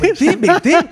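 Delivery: high, laughing "go away"; half-laughing urging him to leave; a pleading laughing shout at the screen; laughing plea. Pretend.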